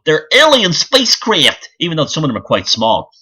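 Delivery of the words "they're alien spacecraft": high, eager mock believer voice; mocking UFO believers. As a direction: parody the gullible UFO crowd. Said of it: "all the U F O people go".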